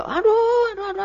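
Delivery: high-pitched mock "aduh" howl; mock wailing. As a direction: imitate someone crying out in pain, starting loud and tailing off. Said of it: "Tidak mengeluh".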